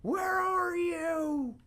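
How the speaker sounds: very high, slow drawn-out call; mock summoning call. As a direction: call out like a ghost demanding it appear. Calling out to hidden object.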